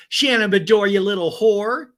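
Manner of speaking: high, loud sing-out of the insult; teasing rhymed greeting. Acted. Host repeating a castmate's rhyming insult, gleeful teasing. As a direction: crow the rhyme with mock delight.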